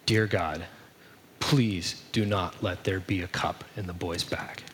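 low, hushed plea to God; retold prayer in a low, soft voice; little prayer. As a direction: pray it softly, pausing after the opening. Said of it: "offers up this little prayer to God".

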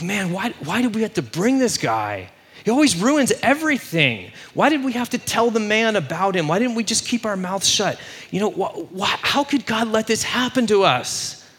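high-pitched exasperated complaint; freaking out. Retold or imitated. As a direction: whine it as brothers bemoaning their luck. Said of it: "they're just freaking out".

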